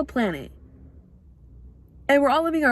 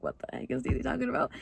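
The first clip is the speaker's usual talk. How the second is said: softer, puzzled mock viewer; 3 semitones higher, 8 dB quieter, faster than usual. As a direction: play a baffled viewer, quietly. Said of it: "be like".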